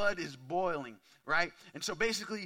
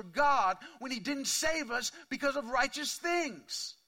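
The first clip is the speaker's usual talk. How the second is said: high, emphatic preacher delivery, louder on key words; impassioned preaching; 8 semitones higher, 5 dB louder than usual. Real, not acted. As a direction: drive each phrase home to the congregation, raised pitch. Preaches at full force.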